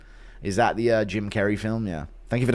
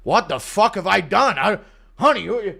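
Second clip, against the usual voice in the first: confused voice climbing higher and louder; bewildered man working himself into a panic; panicked confusion, rising; 6 semitones higher, 4 dB louder than usual. Retold or imitated. Chandler at Christmas, suddenly lost, rising to a panicked yell. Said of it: "He's like".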